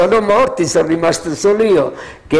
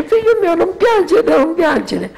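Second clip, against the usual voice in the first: much higher pitched retold mother soothing; voicing the mother; 12 semitones higher than usual. Old nun consoling a kneeling, weeping officer, high-pitched voice.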